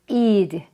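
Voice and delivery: "이드" as a full id syllable; id ending. Shown as a demonstration. Teacher listing three past-tense endings, syllabic "이드". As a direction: say "이드" with a vowel before the d. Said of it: "요렇게 끝나는 끝소리가 다른데요".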